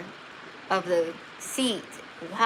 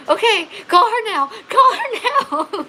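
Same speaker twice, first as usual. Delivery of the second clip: high, loud mock panic; urgent plea; 10 dB louder than usual. Woman in labour telling husband to call for help, urgent. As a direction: cry it out urgently, half laughing.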